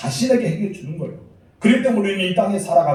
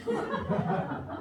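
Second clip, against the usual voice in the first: breathy high laugh; 11 dB quieter than usual. Retold or imitated. Preacher's mocking imitation.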